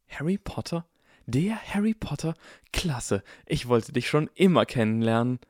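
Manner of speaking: high, breathless fanboy gushing; eager enthusiasm. A narrator's reading. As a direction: gush at meeting an idol. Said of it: "mit eifriger Begeisterung".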